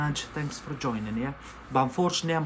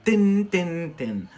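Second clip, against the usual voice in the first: playful loud "tin" snips, higher at first; imitated clipper noise; 4 semitones higher, 5 dB louder, much slower than usual. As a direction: mimic a clipper going tin, tin, tin.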